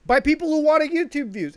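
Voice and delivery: indignant yell at the people abusing animals for views; outraged shout. Real, not acted. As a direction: let the anger burst out on the last words. Outraged about the stabbed puppy.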